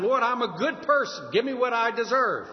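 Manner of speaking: mock entitled prayer; mock self-righteous prayer. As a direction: play the proud petitioner. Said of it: "We're not saying".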